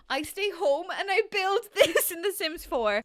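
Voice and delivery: loud, high, laughing wail; crying with laughter. Real, not acted. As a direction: wail it through helpless laughter. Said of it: "I'm crying because".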